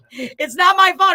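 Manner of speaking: high, loud, slow playful protest; mock protest. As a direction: insist it's not your fault, teasing. Protesting about the flood joke.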